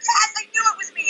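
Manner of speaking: very high, thrilled reply; excited retelling. Retold or imitated. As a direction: reenact your own giddy answer. Retelling own thrilled reply to him.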